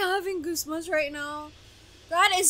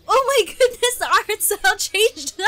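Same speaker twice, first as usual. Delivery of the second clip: higher, louder exclamation; thrilled; 6 semitones higher, 7 dB louder than usual. Real, not acted. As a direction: marvel at the new look. Art style changes.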